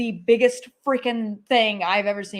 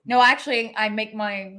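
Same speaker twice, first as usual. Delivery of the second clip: ordinary talk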